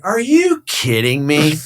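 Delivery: slow, high-pitched disbelief; retold incredulity. Broker reacting to upset clients, incredulous. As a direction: stretch out the incredulous question.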